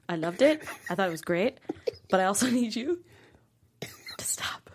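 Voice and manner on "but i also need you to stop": mock stern whisper. Mock stern note to the actor.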